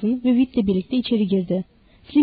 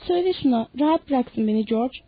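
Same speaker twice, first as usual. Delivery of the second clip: plain reading voice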